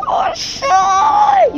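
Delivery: high startled shout; surprised shriek. Real, not acted. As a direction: blurt it out in shock. Surprised exclamation at friend.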